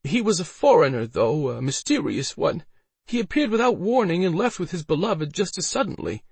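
high-voiced woman explaining herself to a knight; Elsa, pleading her account. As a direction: insist on your account in a high voice.

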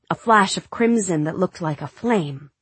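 usual speaking voice

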